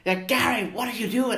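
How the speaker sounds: put-on half-hearted Australian accent calling out to Gary; lightly voiced Australian calling Gary the goat. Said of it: "It's my Australian accent".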